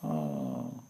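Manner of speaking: soft, low, sorrowful "Oh."; mock crying sigh. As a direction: let out a quiet, hurt moan. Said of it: "Giriraj started crying".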